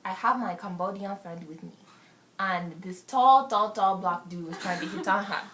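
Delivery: lightly put-on African accent. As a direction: tell the party story in an African accent. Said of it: "I'm gonna go to African".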